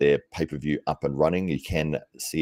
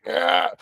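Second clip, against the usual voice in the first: surprised ah; 3 dB louder than usual. Retold or imitated. Failing a press demo.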